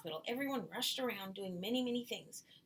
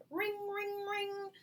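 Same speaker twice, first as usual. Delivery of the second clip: high sing-song ring ring like a bike bell; playful high bell imitation; bell sound; 11 semitones higher, much slower than usual. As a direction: chime it out high like a little bell.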